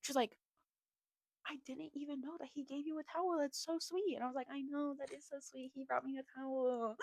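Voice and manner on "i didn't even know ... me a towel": high, soft swooning voice; hushed, pitched-up imitation of girlish gushing. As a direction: coo it like you're both melting. Friend and she gush over towel.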